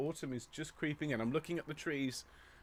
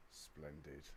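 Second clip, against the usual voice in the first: soft aside; 8 semitones lower, 18 dB quieter than usual. Real, not acted. Soft aside between greetings.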